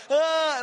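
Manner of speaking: high, strained gasp for air; hammed-up winded cry; mock winded gasp. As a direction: wheeze like the breath was knocked out. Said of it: "And he's like".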